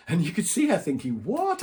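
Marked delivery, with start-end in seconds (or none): 1.2-1.6 a jump way up in pitch on one word; mock disbelief, squeaked high; retold boss hearing the unthinkable, in high surprise; imitated shock. Trainer shocked by his reply.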